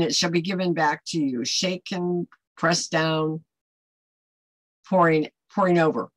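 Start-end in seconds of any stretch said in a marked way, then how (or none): none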